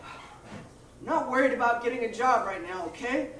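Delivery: very soft, sulky mumble; quiet defensive whine; sulky slacker. Acted. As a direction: brush him off quietly. One-man play, unemployed guy.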